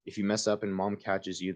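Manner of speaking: ordinary talk, nothing marked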